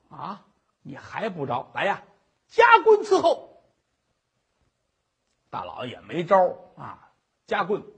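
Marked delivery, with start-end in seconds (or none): 2.5-3.6 high, loud sentencing shout; 大堂喝令; stern order. Acted. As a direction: call for the press from the bench. Magistrate orders the press.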